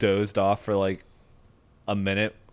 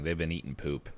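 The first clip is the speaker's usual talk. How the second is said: ordinary talk, nothing marked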